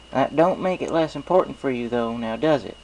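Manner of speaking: folksy farm pony, friendly and sure; Applejack. Acted. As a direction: play a cheerful country mare with a twang. Applejack's voice in story.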